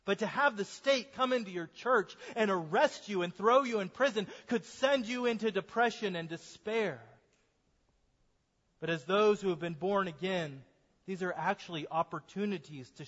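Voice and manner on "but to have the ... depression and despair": ordinary talk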